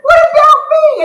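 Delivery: high, loud outraged cry with a laugh; a laughing yelp of disbelief; indignant high laughing shout. Retold or imitated. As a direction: act wounded and shocked, voice cracking up with laughter. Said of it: "I was like".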